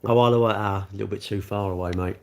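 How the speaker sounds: ordinary delivery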